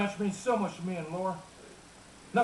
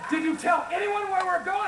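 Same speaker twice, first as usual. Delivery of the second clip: raised, higher-pitched retort; exasperated dad; 9 semitones higher than usual. Retold or imitated. Dad berating his son in the collapsed mine, exasperated. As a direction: play a father at the end of his patience. Dad quarrelling with George underground.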